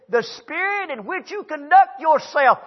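high, impassioned pulpit exhortation; preaching. Real, not acted. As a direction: drive it home to the congregation.

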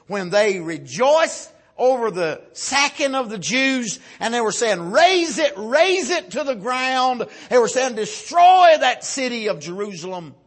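high, shouted pulpit cry; full-force preaching. Acted. Preacher cries out as Edom over fallen Jerusalem, shouted preaching. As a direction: build to a roaring battle cry.

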